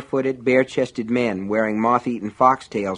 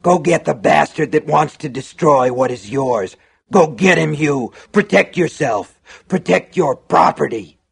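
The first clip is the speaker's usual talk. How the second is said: loud, raised, driving commands; harsh urging; 5 semitones higher, 5 dB louder than usual. A narrator's reading. Shopkeeper goading customer to protect property, harsh urging. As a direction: incite him with mounting force. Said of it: "cried in a low, harsh voice".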